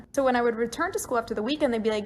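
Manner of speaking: usual speaking voice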